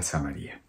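Samaria said "sa-ma-RÍ-a"; stress on the I. Shown as a demonstration. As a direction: lean on the I in Samaria, four syllables. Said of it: "tiene el acento en la I". (Samaria con acento en la i)

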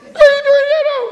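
high, loud half-laughing challenge. Acted. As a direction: call him out with a laugh. Son challenges the stepfather.

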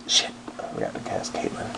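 fast whispered aside. Acted. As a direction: drop out of character and hiss it quick. Whispered aside breaking character.

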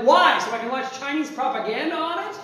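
high, loud, disbelieving rant; fed-up refusal of a TV salesman; exasperated outburst. Retold or imitated. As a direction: throw the questions back in exasperation. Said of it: "You're like".

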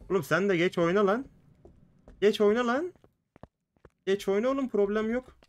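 high, loud call to a customer; mock yell at customer. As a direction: shout it over at him, half joking. Calling out to customer in game.

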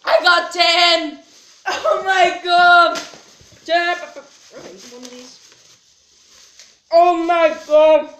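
slow, loud victory yells; triumphant shouting. Real, not acted. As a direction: shout it out like you won. Triumph after ten marshmallows.

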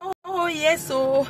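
ordinary talk

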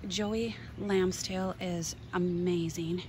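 ordinary delivery

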